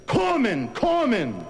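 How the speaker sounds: high calling yell. Acted. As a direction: call across the battlefield. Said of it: "He yells out".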